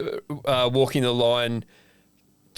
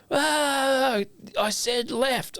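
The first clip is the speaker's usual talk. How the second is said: much higher, slow, impatient correcting voice; shrill receptionist; 12 semitones higher, much slower than usual. Retold or imitated. Retelling the receptionist scolding him, high and slow. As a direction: mimic her snapping the direction at you.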